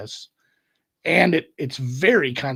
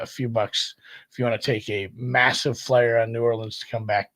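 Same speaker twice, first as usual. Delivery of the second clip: ordinary talk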